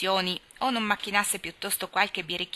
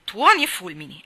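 loud outburst of anguish; exclamation; 8 dB louder than usual. A narrator's reading. Tommy vents his anguish at Nan, loud outburst. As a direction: blurt it out in frustration. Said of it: "esclamò".

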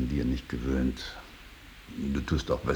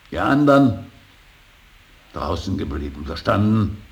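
sharp, loud and high order; stern command; 4 semitones higher, 8 dB louder than usual. Acted. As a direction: bark the order at them. Father orders others to stay out. (scharfer Befehl)

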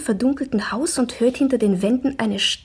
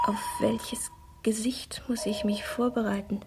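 softer whisper drifting off; hushed whisper; 9 dB quieter than usual. Acted. Alone in the dark room, wondering which face will come, hushed whisper. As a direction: murmur as if half asleep. Dreamlike inner monologue, music.

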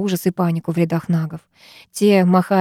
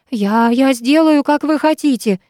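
stammering, higher frightened voice; babbling in fear; 6 semitones higher, 3 dB louder, slower than usual. Acted. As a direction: blurt it out, terrified. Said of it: "пролепетал тот".

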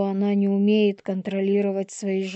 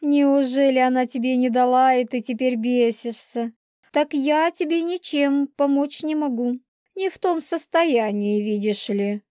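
higher-pitched, mocking woman's voice; taunting pregnant she-wolf; 5 semitones higher than usual. Pregnant Полина teases Елисей about being refused, taunting. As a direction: needle him in a raised pitch.

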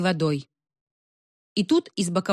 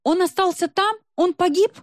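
very high, alarmed questions; panicked crying out; 12 semitones higher, 4 dB louder than usual. A narrator's reading. Girl fearing Pasha died in the fire, high panicked cry. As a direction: cry out in mounting alarm for someone missing. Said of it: "кричала Любочка".

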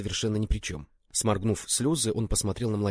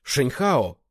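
raised, loud call of a name; startled call; 4 semitones higher, 6 dB louder than usual. A narrator's reading. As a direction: call out urgently to a friend. Startled calling to his friend.

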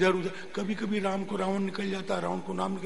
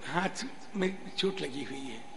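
feigned injury excuse; 2 semitones lower, 8 dB quieter than usual. Acted. Disciple pleading a hurt hand to guru, feigned poorly voice. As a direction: drop the voice to dodge the task.

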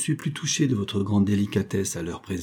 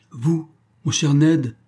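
higher, slow, heartfelt cry; exclaiming; 6 semitones higher, 4 dB louder, slower than usual. A narrator's reading. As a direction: call out to his friend, drawn out. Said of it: "s'écria William Bolton". (s'écrier, plus aigu et lent)

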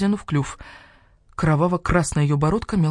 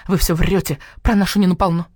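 heated hen voice, louder; indignant hen; 7 semitones higher, 4 dB louder than usual. Acted. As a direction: defend Nina hotly. (возмущённая курица)

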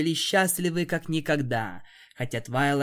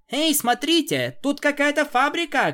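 much higher, louder shout; excited call; 13 semitones higher, 5 dB louder than usual. Acted. Pinkie calls friends to the factory, excited shout. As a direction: call everyone over to look. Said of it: "крикнула Пинки".

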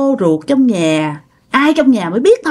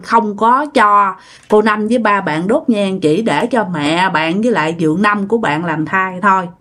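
ordinary delivery, nothing marked

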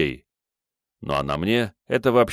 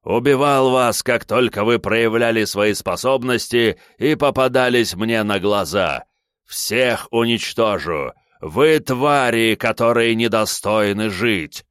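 loud, slow, high furious raving; wild unhinged ranting; 4 semitones higher, 4 dB louder than usual. Acted. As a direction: rant like a madman. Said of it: "окунулся в свою ярость".

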